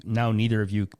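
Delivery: ordinary talk, nothing marked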